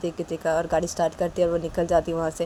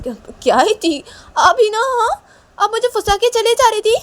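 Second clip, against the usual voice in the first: much higher, louder squeal of protest; indignant protest; 11 dB louder than usual. Acted. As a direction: cry out that she's trapping him. Ronny protests, high voice.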